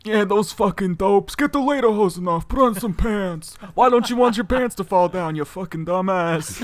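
high, loud put-on accent; Ryan's angry uncle. Uncle ranting about lederhosen wearers, angry accented shouting. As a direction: rant as an exasperated foreign uncle. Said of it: "that was Ryan's uncle, man. He hates Ryan".

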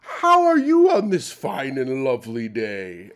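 hammed-up courtly greeting, the second line slow; fussy polite gentleman. Tim greeting the barista in an overly polite gentleman voice. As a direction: greet them like a gent in a monocle and top hat. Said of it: "when you talk like this".

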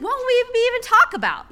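high, rising marvel at the question; wondering aloud. Retold or imitated. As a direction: ask it like it amazes you. Voicing the author's wondering.

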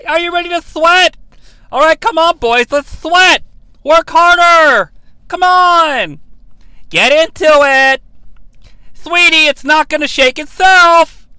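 high, loud, peppy fitness-instructor voice; bouncy workout-video host egging you on; Richard Simmons impression. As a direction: cheer on a sweaty aerobics class. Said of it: "I am getting a massive Richard Simmons here".

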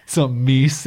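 hammed-up indignant friend; mock indignant correction. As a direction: imitate someone insisting you're wrong. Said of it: "been like".

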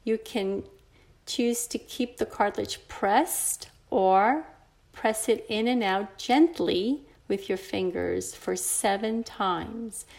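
normal talking voice

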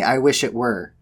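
measured storybook reading voice; story narration. A narrator's reading. Podcaster reading a dark love story aloud, in narrator voice. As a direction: read it like a fireside tale.

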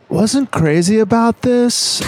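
high, slow drawling surfer dude; surfer bro voice. Acted. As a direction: play a laid-back beach bro. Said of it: "a beach bro".

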